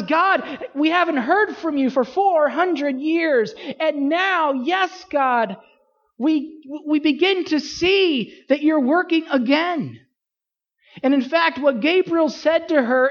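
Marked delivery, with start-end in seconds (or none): 0.0-10.0 excited, higher praise to God; Mary overjoyed at God's work; thrilled Mary. Retold or imitated. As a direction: voice Mary's delight, lifted and eager.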